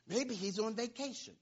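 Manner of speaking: high, taunting mock suggestion. Preacher as Elijah taunting Baal's prophets, mocking. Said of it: "saying it with a tone".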